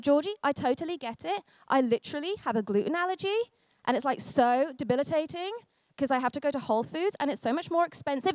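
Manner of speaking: mock a whiny friend who turns statements into questions; imitating sugary passive-aggression with rising endings; passive-aggressive uptalk. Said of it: "this way of talking that's really passive-aggressive".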